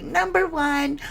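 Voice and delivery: playful imitation of the late friend; imitating a bubbly friend. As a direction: pop it up like her excited greeting. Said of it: "she was like".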